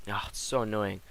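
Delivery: normal talking voice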